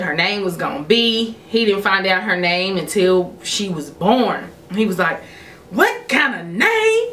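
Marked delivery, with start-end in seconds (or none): 5.7-7.1 high-pitched, incredulous mockery; acted-out scandalized complaint; disapproving father. Dad hearing granddaughter's name, disapproving.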